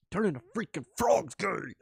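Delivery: high-pitched mock rant voice; mimicking a rant. Streamer quoting the played clip to viewers, mock outrage.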